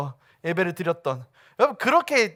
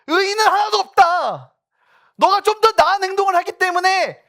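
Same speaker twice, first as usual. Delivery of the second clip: rebuking scripture voice; 12 semitones higher, 9 dB louder than usual. Acted. Preacher voicing Romans as God scolding the self-righteous, loud and stern. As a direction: thunder the verse at the proud. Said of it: "로마서 삼 장 구 절 십이 절 말씀에".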